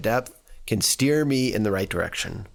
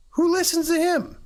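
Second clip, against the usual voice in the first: mocking crowd. Crowd mocks Jesus in reading.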